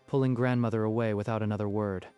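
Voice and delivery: ordinary delivery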